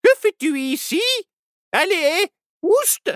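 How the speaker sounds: high-pitched scolding witch; high, imperious witch. A narrator's reading. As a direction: shoo it away as a storybook witch. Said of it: "La voix de la sorcière résonna".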